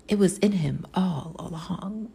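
lightly voiced superhero-story narrator; proclaiming narrator. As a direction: close the tale like a narrator, understated. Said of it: "the narrator in the end proclaims".

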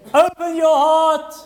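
high, loud, slow call; urgent plea. Real, not acted. Preacher pleading with listeners to open their hearts, loud and high. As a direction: cry it out to the congregation.

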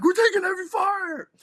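high loud urgent yell; yelled into the radio. Retold or imitated. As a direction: call for help over the radio under fire. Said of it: "I just yelled".

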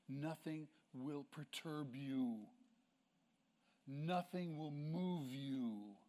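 slow, settled reassurance; calm assurance. Shown as a demonstration. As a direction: draw it out slowly and peacefully. Describing peace in God's control.

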